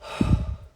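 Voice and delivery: audible slow breath out; long calming sigh. Shown as a demonstration. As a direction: demonstrate a deep release breath. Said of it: "okay, I need to breathe".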